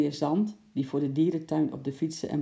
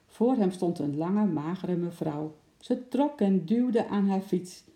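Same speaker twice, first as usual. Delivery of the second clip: ordinary delivery, nothing marked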